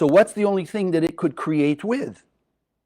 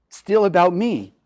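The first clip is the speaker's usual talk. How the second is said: ordinary delivery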